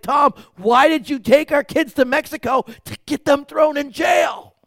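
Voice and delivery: high, loud mock yell; hammed-up indignant shout, stretched at the end; outraged parent. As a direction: imitate furious parents shouting an accusation. Imagined angry parents confronting him.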